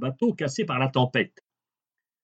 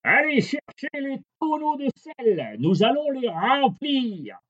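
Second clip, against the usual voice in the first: calling captain; 13 semitones higher than usual. A narrator's reading. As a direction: shout orders to the sailors from up high. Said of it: "le capitaine appela les matelots".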